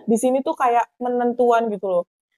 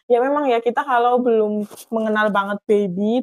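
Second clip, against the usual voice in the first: usual speaking voice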